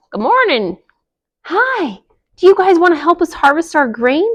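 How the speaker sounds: friendly hen calling to neighbors; mama hen and chick asking for help; lightly voiced chickens.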